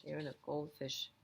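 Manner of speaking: ordinary delivery, nothing marked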